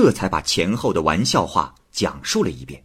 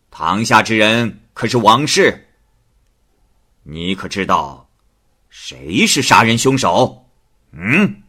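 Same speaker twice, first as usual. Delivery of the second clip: louder, slow official questioning; stern magistrate; 3 semitones higher, 5 dB louder than usual. Acted. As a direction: interrogate from the bench. 太守审问王氏. (太守威严审问王氏)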